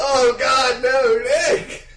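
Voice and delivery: high, loud objection through laughter; real cracking-up dismay; laughing protest. Real, not acted. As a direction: protest in amused outrage.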